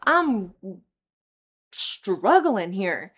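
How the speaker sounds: loud and high, raw desperation; exasperated yelled plea; retold outburst. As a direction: shout it out to the whole room. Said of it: "I get up there and I'm like".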